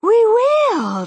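high, loud eager reply; eager cry. A narrator's reading. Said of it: "they cried".

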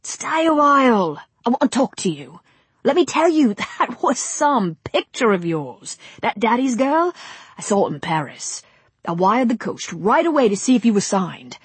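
eager film director with a laugh; pushy producer charm; brash director. Acted. Brady flatters Rosemary.

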